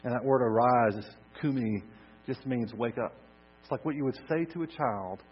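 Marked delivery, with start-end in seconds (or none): none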